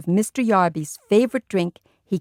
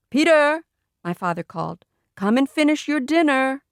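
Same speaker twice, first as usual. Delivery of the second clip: calling out; 6 semitones higher than usual. A narrator's reading. Father calling across house.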